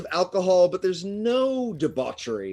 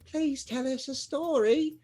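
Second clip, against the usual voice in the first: put-on high pleading child voice; small children begging for a story; halfling child; 11 semitones higher than usual. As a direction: beg like a tiny child. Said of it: "little halfling children are like".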